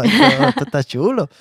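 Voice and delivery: thrilled, pitched well up; voicing a sudden rush of excitement; retold inner voice lit up with delight; excited thought. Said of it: "y de pronto digo".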